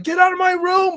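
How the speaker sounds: high, loud, unsteady yell; flickering voice. Shown as a demonstration. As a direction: shout it with a voice about to crack. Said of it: "your voice is flickering".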